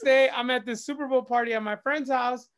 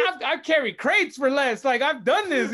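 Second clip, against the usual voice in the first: heated venting; 5 semitones higher, 4 dB louder than usual. Retold or imitated. Venting about paying his dues.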